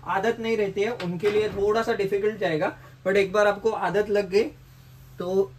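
muffled by cloth mask; speaking through balaclava; through a face covering. Real, not acted. Seen 5: Balaclava covers mouth until 5.2; helmet already off throughout.

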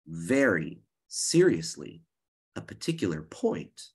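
slow, weighty emphasis; slow down to drive the point home; slow and serious. Shown as a demonstration. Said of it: "I might slow down".

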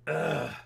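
loud groan of throat pain. Real, not acted. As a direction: groan as if swallowing hurts. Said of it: "It hurts to swallow".

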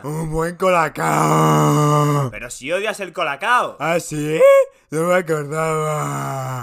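slow, spaced-out, lightly voiced; dazed voice. Mexico after his colacao, slow and spaced-out. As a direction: drift through it, out of it.

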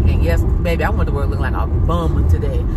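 normal talking voice

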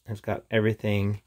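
ordinary delivery

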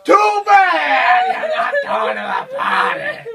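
high-pitched teasing taunt; high mocking taunt. Father mocking daughter.

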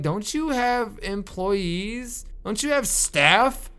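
high, slow mock-indignant complaining; indignant guest. Guest scolds the hotel owner, high slow mock indignation. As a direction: play the offended hotel guest. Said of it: "I'd be like".

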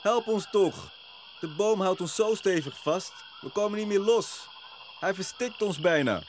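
high-pitched desperate pleading; distressed yogis crying out in a high voice; calling out for help. A narrator's reading. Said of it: "In koor roepen de yogis".

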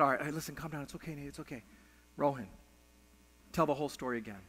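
retold quiet, patient father; calming reassurance. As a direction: settle a crying child down softly. Father soothes crying son, instructs friend.

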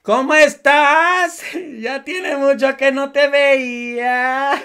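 slow, put-on saccharine greeting; mocking imitation. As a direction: parody her fake friendliness. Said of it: "Y ella". (imitación burlona y melosa de la política)

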